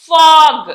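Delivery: ఫాగ్ loud, ending in a hard "g"; ఫాగ్ said singly with a firm final "g"; final G sound. Shown as a demonstration. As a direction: demonstrate the released g closing ఫాగ్. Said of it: "sound గానే పలుకుతాం".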